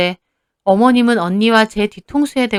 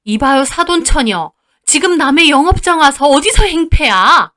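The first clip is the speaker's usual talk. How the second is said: raised voice, building louder; indignant counterattack; 5 semitones higher, 5 dB louder than usual. Acted. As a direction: challenge the intruder. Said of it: "곧바로 반격을 하시더라고요". (언성 높여 맞받아치는 시어머니)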